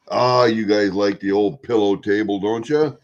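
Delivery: ordinary talk, nothing marked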